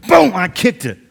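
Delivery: building to the big moment; emphatic retelling. Retold or imitated. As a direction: land the kick with force. Retelling kicking the trash can.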